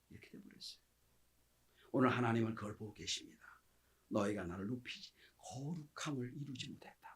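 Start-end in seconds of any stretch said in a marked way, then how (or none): 4.1-7.2 God's hushed rebuke. Acted. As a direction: rebuke them quietly and gravely. Preacher voices God's grave rebuke.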